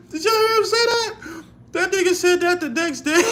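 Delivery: shrieking with laughter at the audience; laughing in disbelief. Real, not acted.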